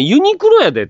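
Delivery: exasperated mock retort.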